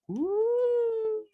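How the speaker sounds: very high, sustained woo; piercingly high party cheer; dancing whoop. Acted. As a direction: squeal a long woo while dancing.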